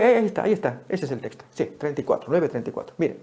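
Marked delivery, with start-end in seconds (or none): none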